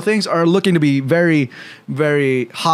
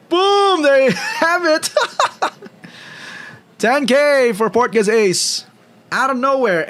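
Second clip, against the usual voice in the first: excited hype shout; 11 semitones higher than usual. Real, not acted. Big hit lands.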